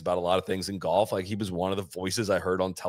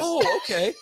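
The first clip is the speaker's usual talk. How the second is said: a higher, louder, pleasant oh okay; nice polite brush-off; 12 semitones higher, 4 dB louder, slower than usual. Retold or imitated. Bobby answering the podcast host, a higher polite oh okay. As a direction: imitate a friendly but final reply. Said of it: "I thought it was gonna be like".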